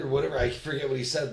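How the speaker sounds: ordinary talk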